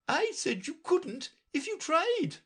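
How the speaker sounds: very high, imperious royal voice; Red Queen. A narrator's reading. Red Queen lecturing Alice, high and imperious. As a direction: talk down to the child.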